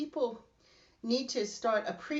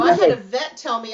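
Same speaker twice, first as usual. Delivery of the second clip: usual speaking voice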